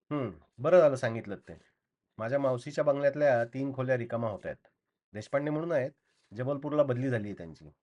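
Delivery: ordinary delivery, nothing marked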